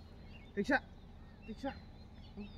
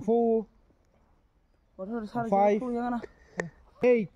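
straining with effort; 2 semitones higher, 5 dB louder than usual. Real, not acted. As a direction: push out each number. Slow strained counts, tiring.

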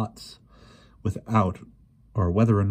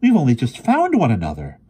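high, loud, anguished plea; heartfelt, pleading outburst; distressed exclamation; 7 semitones higher, 7 dB louder than usual. A narrator's reading. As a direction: cry it out in protest. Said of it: "Ariel exclaimed".

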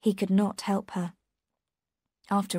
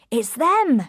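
eager, louder and higher, relief bursting out; excited; 7 semitones higher, 6 dB louder than usual. A narrator's reading. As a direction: call it out with joy as the riders arrive. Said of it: "said Richards excitedly".

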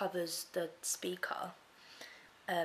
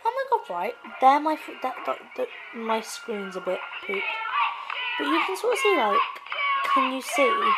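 excited loud; 6 semitones higher, 13 dB louder than usual. Real, not acted. Excited showing of the screen.

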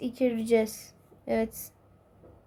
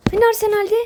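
indignant outburst; 8 semitones higher, 5 dB louder, much faster than usual. Acted. Angry at wrong match.

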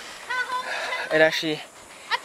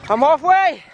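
excited; 7 semitones higher, 6 dB louder than usual. Real, not acted. Crossing scary bridge.

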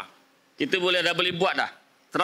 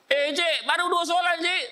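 surprised protest; 10 semitones higher than usual. Retold or imitated. Dismissed bus driver begging the interviewer, high surprised protest. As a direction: pitch jumps high, pleading.

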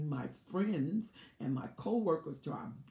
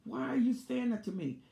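staged outcry; 6 semitones higher than usual. Retold or imitated. Narcissist's staged outburst.

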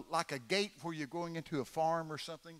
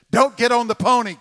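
warning voice; 6 semitones higher, 16 dB louder than usual. Retold or imitated. Mysterious voice warns child.

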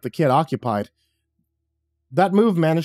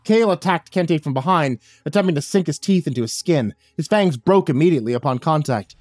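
ordinary delivery

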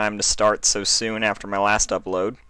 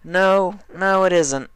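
higher, louder tomboy pony saying no; higher, louder dismissive Dash; Dash, flat refusal; 12 semitones higher, 5 dB louder, slower than usual. Acted. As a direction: shut it down as Dash. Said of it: "Dash replied".

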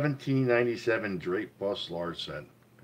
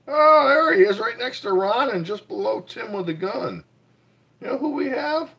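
excited; 12 semitones higher, 6 dB louder than usual. Real, not acted. Spotting a friend on stream.

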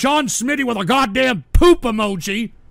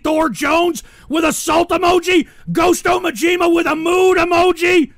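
higher and louder, yelled list; exasperated yell reading the chat; exasperated shout; 8 semitones higher, 5 dB louder than usual. Real, not acted. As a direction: shout the names out, fed up. Reading emoji spam, losing it.

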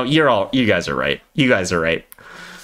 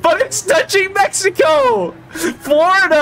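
exasperated shout; 12 semitones higher than usual. Real, not acted. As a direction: yell it in disbelief. Heated argument, shouting.

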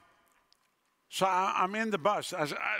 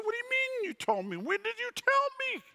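much higher, pleading disbelief; high, outraged bafflement; incredulous protest. Retold or imitated. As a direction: cry out in protest at God. Protesting to God.

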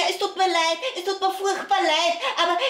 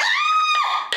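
erschrockener Aufschrei. Acted. Kasperl startled by the shout.

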